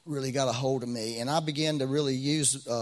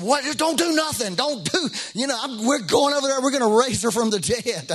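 high, loud, frantic outburst; urgent alarm; 10 semitones higher, 6 dB louder than usual. Retold or imitated. Pastor reacting to a frantic midnight phone call, urgent alarm. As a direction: blurt it out urgently at a raised pitch.

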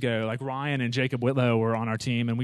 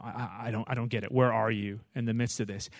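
hushed doubtful prayer; 4 semitones lower, 7 dB quieter than usual. Retold or imitated.

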